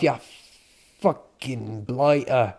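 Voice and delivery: put-on very slow, drawn-out British accent; mock British accent. Guard insulting tourists, slow mock British accent. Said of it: "British things to say".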